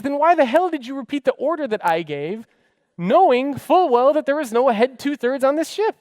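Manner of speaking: angry. Retold or imitated. Angry captain questions navigator.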